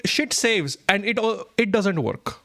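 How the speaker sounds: ordinary talk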